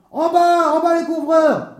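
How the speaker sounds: furious high shout; enraged official yelling, loud and high. Acted. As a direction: bellow orders up at the roofers. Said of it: "hurla-t-il".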